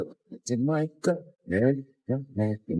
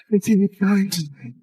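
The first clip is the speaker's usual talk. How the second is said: high-pitched, wailing mock sob; mock wail, high and loud; mock crying wife; 6 semitones higher, 6 dB louder than usual. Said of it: "she cried".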